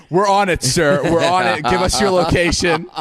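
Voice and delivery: loud, high mock-heroic dispatcher; over-eager cops. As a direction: imitate police thrilled to get a call. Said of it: "the office just lit up".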